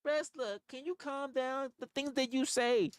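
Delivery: high mocking voice of people telling him to calm down; mocking critics. To viewers, mimicking people who tell him to calm down, high mock voice. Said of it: "people to this day tell me".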